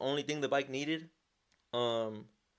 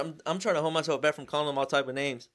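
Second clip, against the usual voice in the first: normal talking voice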